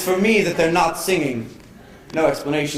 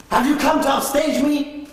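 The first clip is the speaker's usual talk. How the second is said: mock indignant; 11 semitones higher than usual. Flower upstaging the actor.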